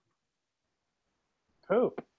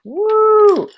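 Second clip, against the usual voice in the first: cheering; 5 dB louder than usual. Real, not acted. Celebrating success.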